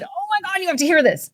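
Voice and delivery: high, eager outburst; bursting to share a story; he can't wait to tell it; excited. Retold or imitated. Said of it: "this guy stood up and said".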